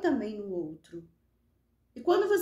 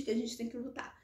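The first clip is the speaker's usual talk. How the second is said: ordinary delivery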